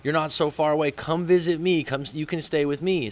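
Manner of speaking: lightly voiced eager friend inviting him over; imitating the friend. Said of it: "she's like".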